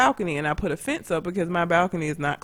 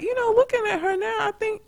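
lightly mocked politeness; 13 semitones higher than usual. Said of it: "I was like".

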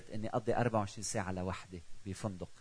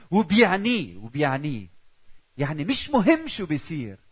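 loud, higher, rousing sermon peaks; fiery preaching; 4 semitones higher, 7 dB louder than usual. Acted. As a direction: preach it out to the congregation. Builds to full-force preaching.

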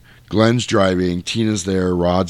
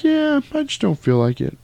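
high, put-on airy mom voice; mock a sweetly upbeat mother; pitched way up, carefree; blissful mom; 7 semitones higher, faster than usual. Voices the mom swearing off drink.

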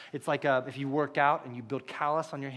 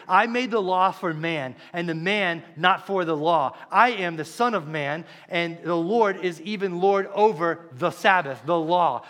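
louder, building sermon drive; preaching at full force; 4 dB louder than usual. Acted. Preacher to his flock, rising to a loud driving delivery. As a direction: press the point home to the congregation.

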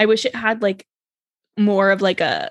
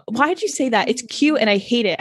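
ordinary talk

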